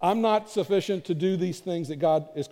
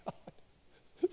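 breathy whisper of disbelief; whispered prayer; 14 dB quieter than usual. Retold or imitated. Pastor whispers to God in disbelief after praise, whispered.

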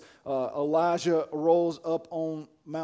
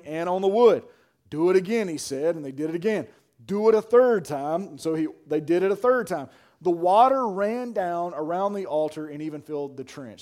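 raised, loud pulpit reading; preached scripture reading; 3 semitones higher, 6 dB louder than usual. A narrator's reading. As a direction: read the verse out with force.

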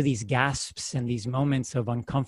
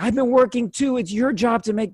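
higher mock husband arguing back; acted quarrel, raised voice; mock argument; 7 semitones higher, 3 dB louder than usual. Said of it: "him being like".

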